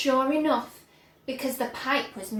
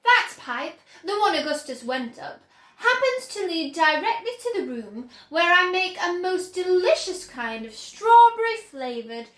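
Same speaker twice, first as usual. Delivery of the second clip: Wonka explaining; 6 semitones higher, 7 dB louder than usual. A narrator's reading.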